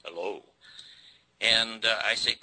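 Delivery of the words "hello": low, soft imitation of a deep baritone voice; deep baritone hello. Telling the host how the DA answered, low baritone. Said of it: "this bass baritone voice".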